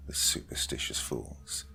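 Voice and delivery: breathy scornful whisper. A narrator's reading. Narrator sneers at the villagers in a whisper. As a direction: hiss the insult under your breath. Dark tale of witch grandmother.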